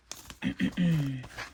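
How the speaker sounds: throat clear after a pause. Real, not acted. Host about to start the budget, throat clearing. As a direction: clear the throat before going on.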